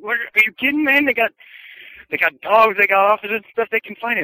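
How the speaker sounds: high, loud incredulous protest; agitated disbelief. Retold or imitated. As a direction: push back in high-pitched disbelief. Other guy disbelieving, agitated.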